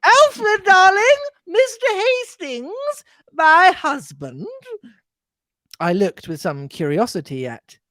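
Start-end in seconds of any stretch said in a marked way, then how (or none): 0.0-4.5 high, slow doting wife; gushing old lady. Acted. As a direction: present the husband proudly, cooing. Mrs Inglethorpe introduces husband.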